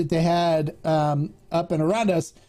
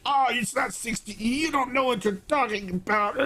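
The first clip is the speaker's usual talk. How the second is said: dismissive shopkeeper; 6 semitones higher, 3 dB quieter than usual. Retold or imitated. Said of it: "And they're like".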